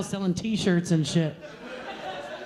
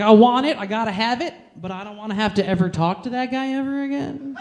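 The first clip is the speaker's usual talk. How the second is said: ordinary delivery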